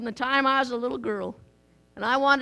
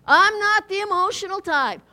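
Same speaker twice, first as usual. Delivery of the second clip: a hint of a stiff, proud churchgoer, a touch louder; mock stiff church member; 4 semitones higher, 6 dB louder than usual. Preacher quoting the congregation, lightly mock-proud. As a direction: repeat the claim with mock pride. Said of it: "You heard that?".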